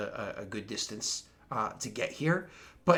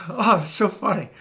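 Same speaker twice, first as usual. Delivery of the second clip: mock amused; 7 semitones higher, 8 dB louder than usual. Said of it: "it's like".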